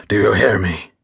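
lightly voiced vengeful burning man; vengeful curse. A narrator's reading. Copper cursing the mob from the stake, lightly voiced menace. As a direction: threaten them from beyond, a hint of menace.

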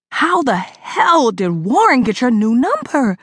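slow tight anger through clenched teeth. Acted. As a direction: hold back fury, teeth clenched. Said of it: "I asked through clenched teeth".

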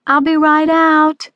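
put-on high, loud, slow child's shout; shouting back. A narrator's reading. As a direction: call back through the door. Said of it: "he shouts back".

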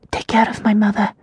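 hushed, breathless whisper; barely voiced words of despair. Prisoner Tasia to her servant, whispered.

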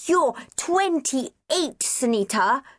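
put-on high, slow child's voice; small boy. A narrator's reading. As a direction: tease her like a little boy. Small boy teasing sister.

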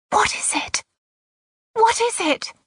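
very high, gasped question; frightened gasp. A narrator's reading. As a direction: gasp it out in alarm, high-pitched. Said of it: "Missus Lennox gasped".

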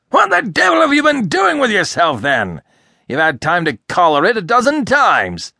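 high, loud outburst at an idle helper; exasperated scolding. A narrator's reading. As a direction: explode with impatience. Irascible boss scolds his helper.

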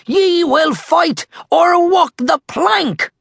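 high, angry pirate shout; thundering pirate threat. A narrator's reading. Captain Red threatening his crew, thundering shout. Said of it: "Red thundered".

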